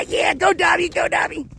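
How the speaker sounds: high, excited cheer. Real, not acted. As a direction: cheer the dog as it runs. Cheering the running dog on.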